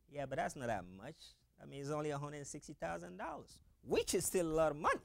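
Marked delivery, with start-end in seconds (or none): 0.1-3.6 much softer, offhand aside; quietly making light of it; mock downplaying. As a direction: drop the volume to shrug the figure off. Playing down a big sum.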